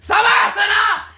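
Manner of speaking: high, drawn-out yell; imitated yoga instructor's shout. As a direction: cut in with a long commanding holler. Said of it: "me grita como".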